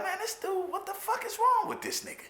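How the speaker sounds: hammed-up baffled outrage; mock disbelief. Nineteen-year-old me, baffled by cellmate's flushing rule. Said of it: "I'm like".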